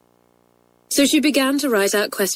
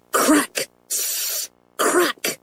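higher, punchy crack; vivid sound words; 8 semitones higher than usual. A narrator's reading. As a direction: pop each crack out. Narrated explosions.

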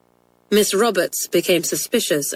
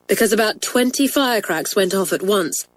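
usual speaking voice

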